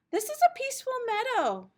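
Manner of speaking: plain reading voice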